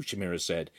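usual speaking voice

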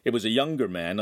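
plain reading voice

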